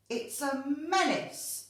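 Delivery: high, loud snap of disapproval; cross, snapping headmistress; snapped. A narrator's reading. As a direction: snap it out, stretching the key word. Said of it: "snapped Miss Bloat".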